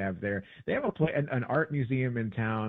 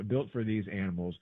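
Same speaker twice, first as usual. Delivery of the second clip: ordinary talk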